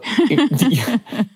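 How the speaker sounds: short high burst of laughter; real laugh. Real, not acted. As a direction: laugh it off, amused at the irony. Laughs at his own irony.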